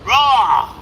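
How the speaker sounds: loud high roar; big playful lion roar. Shown as a demonstration. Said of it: "Is that what you mean?".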